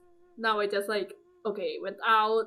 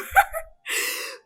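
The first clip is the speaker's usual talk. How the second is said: airy laugh; 4 dB louder than usual. Real, not acted. Laughs at dismissive quote.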